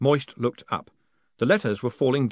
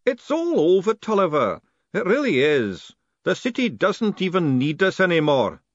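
lightly voiced weary old postman, a bit raised; old postman tells Tolliver it's over, a touch higher; 8 semitones higher, 4 dB louder than usual.

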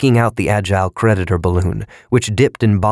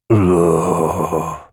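disgusted ugh. A narrator's reading. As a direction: grunt it out with distaste. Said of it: "mumbled in disgust".

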